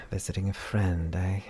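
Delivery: ordinary delivery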